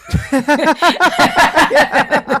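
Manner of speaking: loud burst of laughter; a real, loud laugh; big laugh. Real, not acted. Laughing at the kettle cliche.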